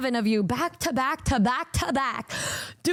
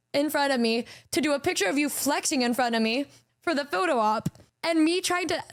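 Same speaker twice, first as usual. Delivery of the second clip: usual speaking voice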